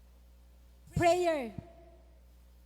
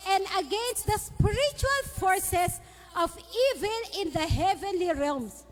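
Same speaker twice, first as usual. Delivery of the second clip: scripture reading; 3 semitones higher than usual. Acted. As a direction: proclaim each line, stretching the key words. Reads Ephesians over music.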